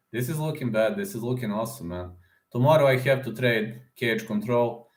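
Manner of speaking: ordinary talk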